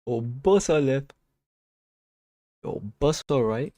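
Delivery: lightly imitated Jamaican accent. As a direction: try out a Jamaican accent, slowly, half to yourself. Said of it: "like, a Jamaican accent the other day".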